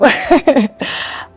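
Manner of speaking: real laugh. Real, not acted. Laugh after retold refusal.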